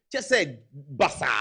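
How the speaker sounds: ordinary talk, nothing marked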